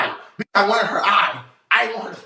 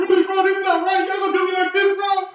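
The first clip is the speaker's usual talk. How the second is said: high, indignant voice of a betrayed guy; high whiny upset guy; 11 semitones higher than usual. Retold or imitated. Mimicking the guy complaining his friends turned on him, high and indignant. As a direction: squeal it like he can't believe it. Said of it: "He's like".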